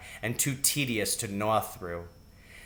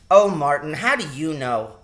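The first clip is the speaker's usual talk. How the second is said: put-on loud protesting girl; 3 semitones higher, 5 dB louder than usual. A narrator's reading. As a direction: push back with an indignant oh. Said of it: "protested Teresa".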